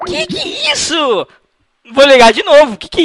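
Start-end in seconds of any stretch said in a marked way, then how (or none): 0.1-1.3 high, slow incredulous gasp of a question; shocked. Real, not acted. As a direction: act utterly scandalized. Prank call victim insulted. (espantado, voz aguda e lenta)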